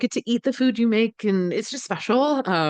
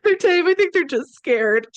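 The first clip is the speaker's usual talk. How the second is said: imitated disbelief, much higher and louder; incredulous friend; 10 semitones higher, 5 dB louder, slower than usual. Friend doubting the farmer's taming claim, imitated high incredulous retort. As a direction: question it loudly, high-pitched, then object. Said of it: "And she's like".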